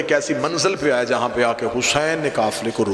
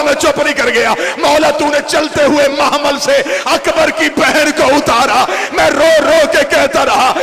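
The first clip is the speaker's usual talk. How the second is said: wailing lament building; 7 semitones higher, 5 dB louder than usual. Acted. Preacher as Abbas recounting refusals, building to a wailing cry. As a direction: lament climbing from full voice to a high, loud wail. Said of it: "मैं रो के कहता रहा".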